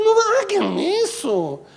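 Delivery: high, slow pleading devil; high whiny devil, pleading. Pretend. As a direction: beg like a panicked demon.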